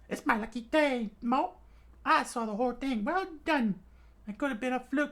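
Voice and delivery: high, squeaky gloating with nyak laugh; smug rat captain. Acted. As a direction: gloat like a greedy little rat.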